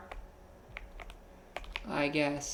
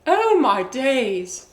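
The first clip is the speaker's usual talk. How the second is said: slow, high, loud British Oh my days; drawn-out playful British exclamation; mock British accent; 7 semitones higher, 8 dB louder, much slower than usual. Streamer reacting to chat in a drawn-out mock British accent. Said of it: "alien accent popping up".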